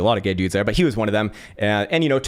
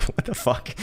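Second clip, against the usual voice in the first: whispered what the fuck; 5 dB quieter than usual. Retold or imitated. Inner reaction to creepy follower.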